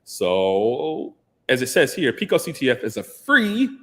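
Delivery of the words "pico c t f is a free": free stretched out and lifted high at the end; emphasis on free. Real, not acted. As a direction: lean hard on the word free, pitch jumping up. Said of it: "emphasis on free".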